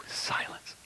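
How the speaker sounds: breathy single whisper. Shown as a demonstration. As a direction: let the word fall silent. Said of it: "All of a sudden".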